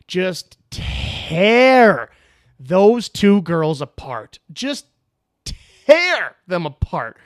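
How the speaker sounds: "tear" stretched long, loud and high; emphatic relish. Real, not acted. Boasting how his team went after critics, "tear" stretched with relish. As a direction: hit "tear" hard and draw it right out.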